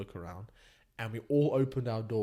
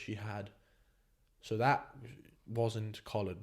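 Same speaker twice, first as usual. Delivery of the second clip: ordinary delivery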